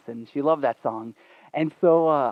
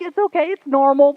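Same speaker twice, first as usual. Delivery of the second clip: high-pitched, loud reassurance; mock insistence; 12 semitones higher, 8 dB louder than usual. Boyfriend persuading reluctant girlfriend, high-pitched insistence.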